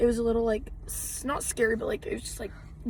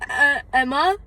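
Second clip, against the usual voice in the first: louder hammed-up stumble, "Emma" rising as a question; hesitant stutter; 3 semitones higher, 7 dB louder, slower than usual. Girl replaying her fake name to fans, exaggerated hesitant. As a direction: play up an unconvincing fib. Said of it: "You stuttered. Your name is not Emma".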